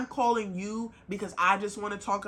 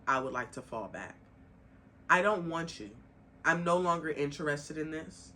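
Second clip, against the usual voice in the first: lower, quieter calm confession; example of honest partner; 8 semitones lower, 4 dB quieter than usual. Pretend. Showing a partner how to admit they're drained, low and gentle. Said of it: "voicing what partner should say".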